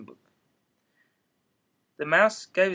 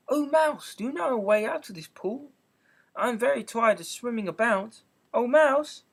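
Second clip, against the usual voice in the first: put-on high, raised girl's call; Alice calling out; 6 semitones higher, 3 dB louder than usual. A narrator's reading. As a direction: call out to the mouse politely.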